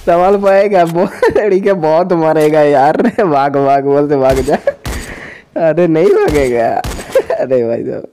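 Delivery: ordinary talk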